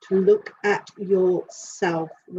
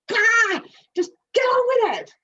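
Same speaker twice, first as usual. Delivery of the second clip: much higher, loud, bursting yell; excited impatient cry; 6 dB louder than usual. Retold or imitated. Voicing the overexcited dog, high, loud and impatient. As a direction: burst out like a dog who can't wait.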